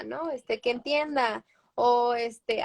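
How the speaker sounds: ordinary talk, nothing marked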